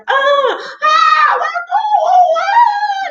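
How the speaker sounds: very high, loud yelps; comic high-pitched screaming; falling screams. Acted. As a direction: scream as if tumbling down a hole. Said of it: "he also screamed a lot".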